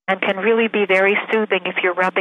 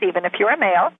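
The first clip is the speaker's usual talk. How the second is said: ordinary delivery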